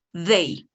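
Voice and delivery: They with a clear voiced th, tongue between teeth. Shown as a demonstration. Teacher repeating They for the class, tongue showing. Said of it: "mostrando la lengua".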